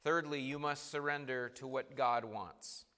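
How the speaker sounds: ordinary talk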